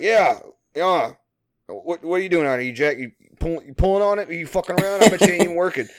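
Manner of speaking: high, loud mock bluster; flustered foreman imitation. Caught foreman deflecting at his worker, flustered mock scolding.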